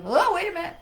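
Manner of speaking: sudden high, loud "wait"; startled interruption. Acted. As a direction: snap out of the flow, alarmed. Voicing an interrupting thought.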